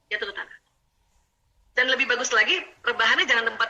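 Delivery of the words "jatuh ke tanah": "Jatuh ke tanah" said again much softer; softly repeated. Shown as a demonstration. Teacher echoing her own words softly to the audience. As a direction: let "Jatuh ke tanah" sink into a soft echo.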